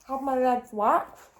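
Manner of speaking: talking through a mouthful of biscuit; mouth full. Real, not acted. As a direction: say it while still chewing. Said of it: "I'm eating with my mouth full now".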